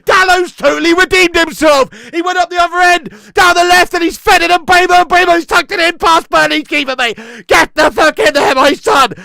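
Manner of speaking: loud, high, breathless recap; excited shouting. Real, not acted. Fan recapping the goal to viewers, excited shouting. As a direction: keep yelling in delight.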